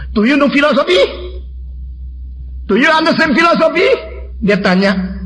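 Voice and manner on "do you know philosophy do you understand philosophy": high-pitched, rising challenge; challenging. Retold or imitated. Young Dutchman testing the preacher on philosophy, challenging. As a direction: imitate a scornful quizzer testing someone. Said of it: "Lalu dia menantang".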